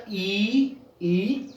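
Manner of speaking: ई short, then ई held longer; correct vowel sounds. Shown as a demonstration. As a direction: say ई and ई as bare vowels, without their names. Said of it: "सही उच्चारण होता है इसका".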